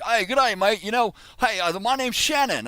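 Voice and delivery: high-pitched, loud mock Australian greeting; bad Crocodile Dundee accent. Shannon greets a stranger on the phone, bad Australian accent. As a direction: introduce yourself in hammed-up Aussie. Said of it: "this is my very bad Crocodile Dundee impression".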